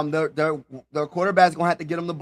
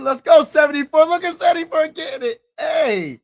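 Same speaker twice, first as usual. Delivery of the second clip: higher, louder hype for the pick; excited cheering; 10 semitones higher, 5 dB louder than usual. Real, not acted. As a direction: cheer your guy on like he just got drafted.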